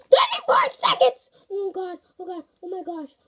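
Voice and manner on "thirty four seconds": high, loud half-crying shout; real countdown panic; panicked shout. Real, not acted. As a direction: wail the time left.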